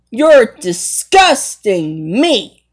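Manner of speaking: ordinary delivery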